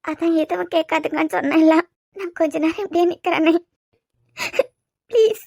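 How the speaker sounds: tearful plea, rising on the last word; crying. Acted. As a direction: plead through tears. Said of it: "சரி, அழாத".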